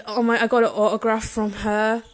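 ordinary delivery

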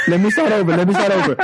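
fixing his slip on the podcast, laughing; laughing restart. Real, not acted. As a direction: chuckle as you restart. Laughing correction.

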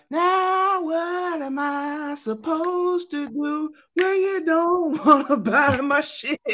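high, slow mock plea; mock Nelson voice. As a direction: whine like a pushy cologne seller. Mocking Nelson selling cologne.

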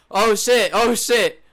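mock panic. Imagining his tandem instructor panicking mid-jump. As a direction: sound the alarm in mock panic. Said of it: "He's like".

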